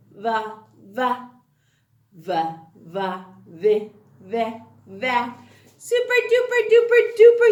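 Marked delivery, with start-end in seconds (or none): 5.8-7.5 fast, loud, high burst of praise; excited praise. Real, not acted. Teacher praising her readers, excited.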